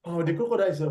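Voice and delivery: usual speaking voice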